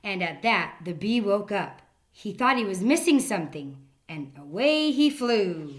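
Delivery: lively narration. A narrator's reading. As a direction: pick up energy as the bee wakes.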